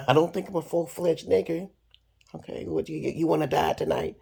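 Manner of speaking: high, sneering retold threat; a hint of a cocky gunman daring him; mock menacing gunman. Retelling a standoff with the gunman.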